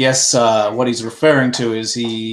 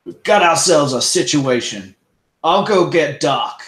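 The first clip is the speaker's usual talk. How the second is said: louder, firm lawman call; 3 semitones higher, 5 dB louder than usual. Acted. Russet to the others, a touch louder and commanding. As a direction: take charge like a sheriff.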